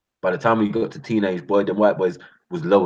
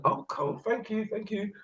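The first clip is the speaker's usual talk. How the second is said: soft grateful thanks; 14 semitones higher, 9 dB quieter, slower than usual. Retold or imitated. Grateful neighbour accepting food.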